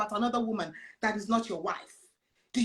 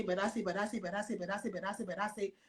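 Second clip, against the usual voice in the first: much softer, murmured but I say over and over; hushed repeated refrain; 5 semitones lower, 11 dB quieter, much faster than usual. Retold or imitated. Preacher echoing Jesus's refrain to the church, hushed. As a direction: drop almost to a hush and keep repeating. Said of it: "you're gonna see Jesus say".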